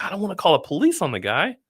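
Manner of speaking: high-pitched, reluctant objection; retold protest. Gym owner objecting to calling the police, protesting. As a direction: act out pleading against the suggestion.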